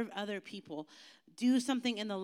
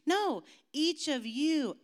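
higher, louder, slow No; emphatic no; 6 semitones higher, 5 dB louder, much slower than usual. Shown as a demonstration. Pastor insisting to the youth everyone has a gift, emphatic. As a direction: land it hard.

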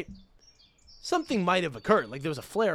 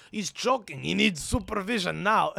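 insistent mock Russian voice, slightly raised; Russian accent; 3 semitones higher than usual. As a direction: imitate an alarmed Russian man. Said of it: "This fucking Russian guy comes in".

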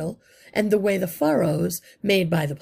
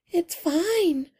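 put-on much higher, small girlish voice; a bashful little girl answering her crush; shy young girl; 12 semitones higher than usual. A narrator's reading. Young girl answering Justin shyly.

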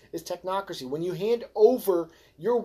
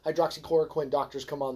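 normal talking voice

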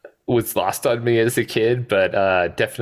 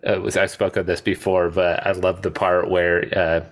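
ordinary talk

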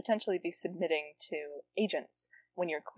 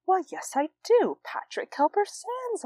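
much higher, prim and slow; mock posh reply; 3 dB louder than usual. Mocking noblemen's small talk, in a high prim voice. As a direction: play a prissy noble showing off trivia.

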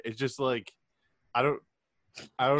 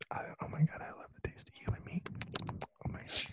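breathy, soft mock whisper; 13 dB quieter, faster than usual. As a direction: whisper it like a guilty craving. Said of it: "Nick would be all like".

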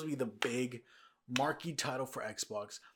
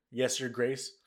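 usual speaking voice

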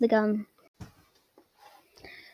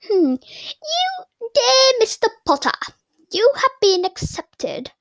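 high, slow, theatrical proclamation; hammed-up high voice reading an official letter; grand letter-reading voice; 9 semitones higher, slower than usual. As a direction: stretch each word out in a lofty put-on falsetto. Reads Harry's letter in put-on voice.

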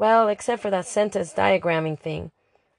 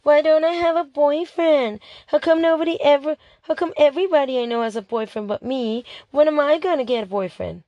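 mocking whiny Mia; 7 semitones higher than usual. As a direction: whine it back at her like a sulky friend. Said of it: "All I ever heard was before".

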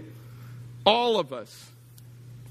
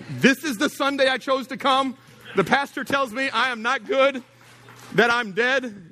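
higher mocking voice of a new visitor; mock offended visitor; 8 semitones higher than usual. Pastor mocks a first-time visitor's complaint, higher and put-out. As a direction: whine it like a put-out newcomer.

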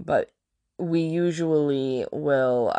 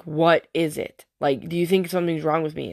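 ordinary talk

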